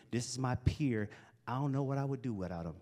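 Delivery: ordinary talk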